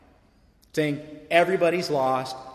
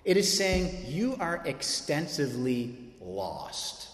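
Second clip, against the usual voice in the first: slow emphasis; much slower than usual. Real, not acted. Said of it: "slow emphatic contrast".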